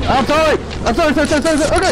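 high, rapid-fire pleading; panicked apology. Real, not acted. Player pleading with an in-game attacker, high panicked apology. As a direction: blurt repeated apologies in alarm.

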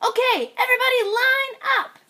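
high, loud commanding piglet; high piglet calling out marching orders; band leader. Acted. Little Pig leads band.

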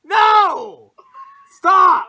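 loud, very high yell; shouting. Real, not acted. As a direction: yell at him to stop. Yelling at a friend to stop.

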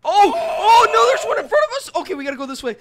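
being chased. Real, not acted. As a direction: yell in fear while fleeing. Fleeing purple monsters.

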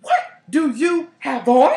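loud, slow, high disbelieving question; alarmed question about the smell; blurted out. Retold or imitated. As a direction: blurt it out without meaning to. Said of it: "I said it out loud".

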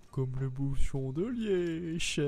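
loud, slow, hammed-up teasing quote; mocked joker. Player imitates whoever makes the cork joke, hammed-up mockery. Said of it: "le premier qui me sort".

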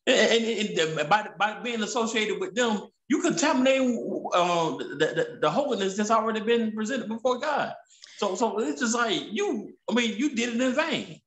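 impassioned rising pitch. Real, not acted. Pitch climbs while preaching.

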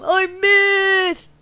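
high, loud drawn-out whine; play-acted dismay; mock distressed wail. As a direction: wail it like a panicking kid. Boy wails after failed throw.

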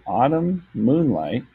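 slow, measured word parts; drawn-out counting read; syllable by syllable. Shown as a demonstration. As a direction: sound it out slowly, syllable after syllable. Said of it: "break up words by syllable".

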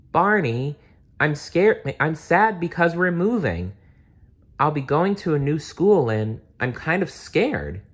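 put-on high childlike worried voice; scared little girl. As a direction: confess fear to a friend. Lori confesses fear.

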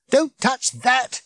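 high, slow urgent yell; sharp yell. Acted. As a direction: stop him before he touches it. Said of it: "yelled Ford".

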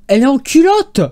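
high, loud outburst of disbelief; shocked disbelief. Real, not acted. Reacting to a seller photographed in underwear, shocked outburst. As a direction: blurt it out, scandalised.

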